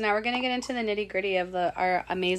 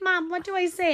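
retold panic, pitched up high; high, rushed plea to her mom; panicked plea; 10 semitones higher than usual. A young server asks her mom for help in a panic. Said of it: "I panicked".

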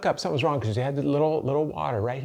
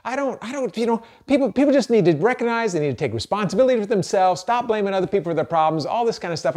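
much higher, mock-defensive outrage; indignant denial; 10 semitones higher, 4 dB louder than usual. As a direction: play your own old self, offended and protesting. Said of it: "your initial reaction for me is".

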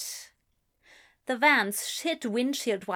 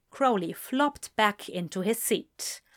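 plain reading voice

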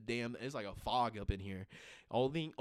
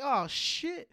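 higher, sudden startled oh shit; higher startled realization; startled surprise; 13 semitones higher, 5 dB louder than usual. Retold or imitated. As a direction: catch yourself in shock. Realizing it's subtitled.

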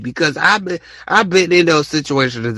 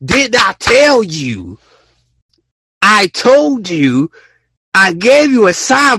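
slow, emphatic higher scolding; scolding God; 6 semitones higher, slower than usual. Retold or imitated. God scolding people who never listen, slow and emphatic. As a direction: lay down God's I-told-you slowly. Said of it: "He just sit there and be like".

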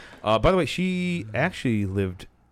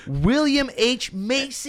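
high, slow, excited announcing; excited drawn-out name; 12 semitones higher, much slower than usual. Acted. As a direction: stretch the name out, thrilled.

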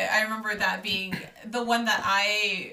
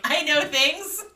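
smug boast; 7 semitones higher, 6 dB louder, much slower than usual. Retold or imitated. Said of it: "I'm like".

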